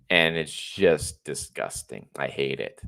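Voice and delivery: normal talking voice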